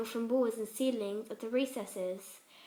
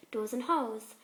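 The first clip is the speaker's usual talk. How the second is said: usual speaking voice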